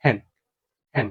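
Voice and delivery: হ্যান said with a clear h breath at the start; sounding the h. Shown as a demonstration. Teacher demonstrates the h sound in হ্যান to students, clearly. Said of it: "হয় কি উচ্চারণ দেখো".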